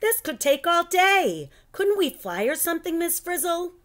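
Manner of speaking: high, urgent plea; anxious kid pleading with his teacher; worried. Acted. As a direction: fret about the time. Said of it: "Carlos was worried".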